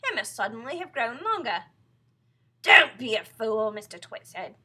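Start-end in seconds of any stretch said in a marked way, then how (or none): 0.0-1.7 raised, louder disbelief; astonished wife. Acted. Mrs Twit alarmed at her stick. 2.6-3.7 loud, slow rebuke; scolding husband. Acted. Husband scolds wife in story.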